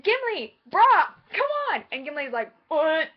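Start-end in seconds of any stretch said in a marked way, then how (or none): 0.0-1.8 high, loud, pleading bro talk; playful, high-pitched urging; Legolas urging. Retold or imitated. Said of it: "and he says". 2.7-3.1 put-on grunted old-man "what"; mock old-timer's confused grunt; old Gimli. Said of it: "'Cause he's old".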